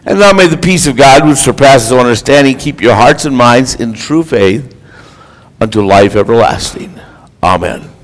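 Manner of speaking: low, subdued pastoral blessing; benediction. Real, not acted. Gives the closing blessing.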